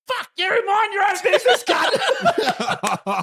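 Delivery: high mocking woman's voice, laughing through it; imitating the woman. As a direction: squeal it back as the bloodied woman. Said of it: "and goes".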